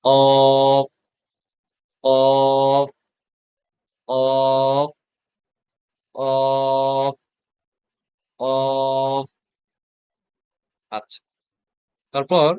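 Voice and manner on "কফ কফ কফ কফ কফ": long held কফ with teeth on the lip; drawn-out Arabic fa. Shown as a demonstration. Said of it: "কফের সঠিক উচ্চারণটা আসবে". (দাঁত ঠোঁটে লাগিয়ে টেনে কফ)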